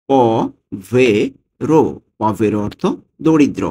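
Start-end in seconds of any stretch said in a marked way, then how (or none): 0.1-1.8 slow repeat. Shown as a demonstration. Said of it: "teacher repeats slowly".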